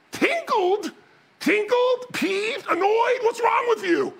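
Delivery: very high-pitched mock-appalled questions; hammed-up outraged bafflement; incredulous exasperation. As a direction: spit back each word in disbelief. Acted disbelief at mild words.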